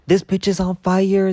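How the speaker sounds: high, loud yell; panicked yell. Retold or imitated. Rolling in the grass on fire, high, loud yell. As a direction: shout it out in panic. Yelling while burning.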